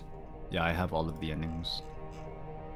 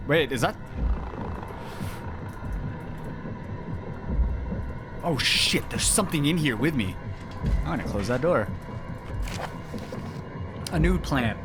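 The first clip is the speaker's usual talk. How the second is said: startled panic; 6 semitones higher than usual. Real, not acted. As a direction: jump at a noise in the dark. Something in the room with her.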